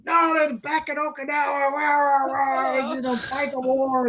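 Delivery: high mock war cry; hammed-up gung-ho veteran; ranting old marine. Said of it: "old marine ranting".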